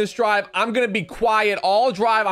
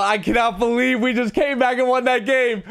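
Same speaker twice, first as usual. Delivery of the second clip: usual speaking voice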